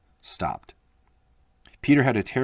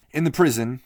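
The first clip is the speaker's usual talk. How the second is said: plain reading voice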